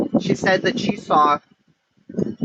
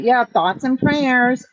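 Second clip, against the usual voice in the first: slow, higher-pitched mock quote; mocking slogan, pitched up and stretched; mocking imitation; 5 semitones higher, slower than usual. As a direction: mimic someone dismissing it, drawn out. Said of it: "That's how it was said".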